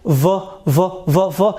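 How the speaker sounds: V as F with voicing added; voiced V. Shown as a demonstration. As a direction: repeat short, buzzing V sounds evenly. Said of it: "add some voicing sound to the f".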